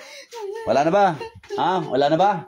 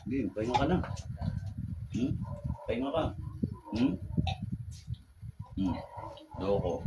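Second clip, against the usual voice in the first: much softer, subdued voice; hushed resting instruction; 16 dB quieter than usual. Real, not acted. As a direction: tell them to rest, very softly. Healer lets the patient rest. (mahinang boses na nagpapapahinga)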